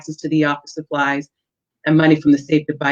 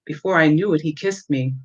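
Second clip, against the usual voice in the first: usual speaking voice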